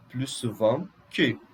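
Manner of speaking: "plus" said as "plu" before souvent; silent S. Shown as a demonstration. Teacher giving second French example, "plus" with silent S. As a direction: drop the final S of "plus". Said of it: "Eu não vou pronunciar o S".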